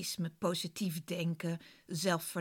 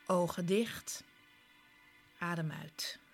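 guided meditation. Acted. Leads a guided relaxation.

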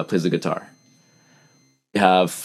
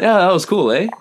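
high, louder Canadian tag question; Canadian "eh" habit; 10 semitones higher, 5 dB louder than usual. Retold or imitated. Visitor chatting in Boston, lively Canadian "eh". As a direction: toss out an upbeat Canadian "eh". Demonstrates his own Canadian eh again.

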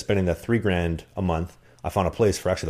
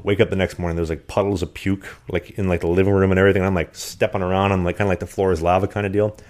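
usual speaking voice

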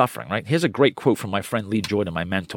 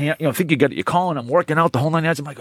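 ordinary talk

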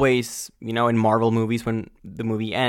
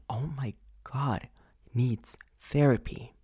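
breathy hushed whisper; whispering it like a shameful secret; 9 dB quieter than usual. Real, not acted. Disgusted aside about a boy.

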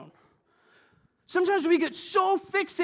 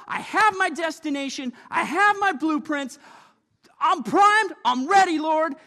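louder, rising, primed and ready; eager declaration; 4 semitones higher, 6 dB louder than usual. Acted. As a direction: announce it like you can't be stopped. Acted confident believer quote.

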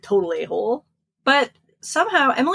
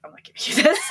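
mock embarrassed refusal; 9 semitones higher, much faster than usual. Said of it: "I was like".

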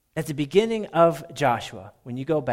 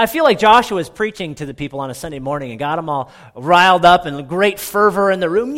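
normal talking voice

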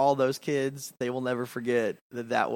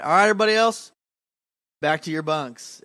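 raised, loud retold call to a group; leader calling out; 4 semitones higher, 7 dB louder, faster than usual. Camp leader dismissing the boys to bunks, retold loud call. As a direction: pitch it up to reach everyone.

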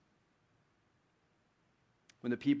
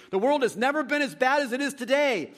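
imitating a doom-saying complaint, higher and louder; mock lament; 9 semitones higher, 7 dB louder than usual. Preacher mimicking people who say things were never this bad, mock lament. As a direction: throw up your hands at the world. Quoting what people think.

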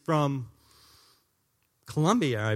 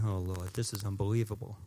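quiet prayer; 8 semitones lower, 8 dB quieter than usual. Retold or imitated. Praying alone while driving.

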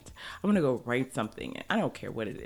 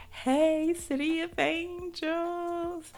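much higher, slow stretched "Hey, City of Angels."; drawn-out greeting; much slower than usual. Real, not acted. Greeting Los Angeles listeners, high and drawn out. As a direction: croon a hello to LA.

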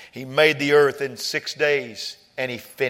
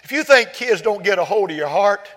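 normal talking voice

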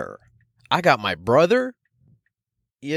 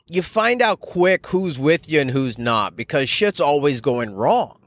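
ordinary talk

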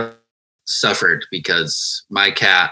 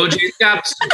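higher mock Boston accent of dad; dad's Boston accent; 10 semitones higher than usual. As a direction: repeat dad's words in his Boston accent. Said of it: "My parents have a Boston accent".

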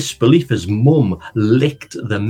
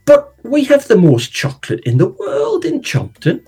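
put-on higher, protesting girl; Jelly's voice; 5 semitones higher than usual.

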